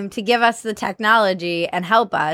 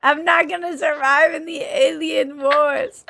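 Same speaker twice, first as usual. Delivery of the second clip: alien voice; 6 semitones higher than usual. Shown as a demonstration. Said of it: "You're getting better".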